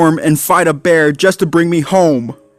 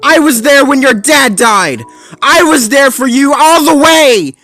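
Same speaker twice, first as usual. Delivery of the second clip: angry shout; 7 dB louder than usual. A narrator's reading. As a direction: scream out the betrayal. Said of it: "He barked in anger".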